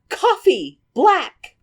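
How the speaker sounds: high, put-on ordering voice; two high, punched words, hammed up; Meg. Newcomer orders at the bar, high put-on voice. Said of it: "comes into the bar and is like".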